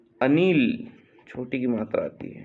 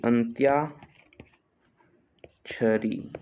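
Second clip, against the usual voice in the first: "अंत्याक्षरी" very slow, long gap midway; split slowly for dictation; much slower than usual. Shown as a demonstration. Teacher dictating a spelling, अंत्याक्षरी slowly in parts. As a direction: break "अंत्याक्षरी" into अंत्या, pause, क्षरी.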